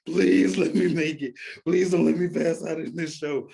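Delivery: high, loud imitation of a desperate plea; tearful pleading. Retelling his prayer before the show, hammed-up tearful plea. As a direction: beg God through half-played tears. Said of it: "I was crying".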